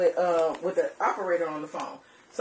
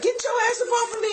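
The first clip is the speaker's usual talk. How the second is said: much higher, fast shouted demand; retold yell; 14 semitones higher, faster than usual. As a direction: fire it back at him, high and fast. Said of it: "I'm like".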